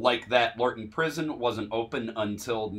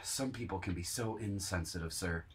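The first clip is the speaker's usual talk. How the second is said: mock sympathetic; 5 semitones lower, 13 dB quieter than usual.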